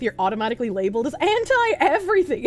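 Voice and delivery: ordinary talk, nothing marked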